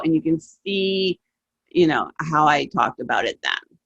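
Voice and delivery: 1 enthusiastic declaration. Real, not acted. Declaring love for the film.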